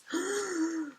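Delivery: high sharp gasp of suspense; dramatic gasp. Shown as a demonstration. Reader teasing kids before the first word, a dramatic gasp. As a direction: gasp to tease the listeners.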